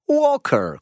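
high squeaky teasing Walker; teasing dwarf. Acted. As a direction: sing out Walker high and sly. Karlica zdradza nazwisko dziada. (wysoko, figlarnie: Walker)

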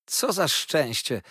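drawn-out, elated exclaiming; happy exclamation. A narrator's reading. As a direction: cry out with happiness. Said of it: "wołał Treadles".

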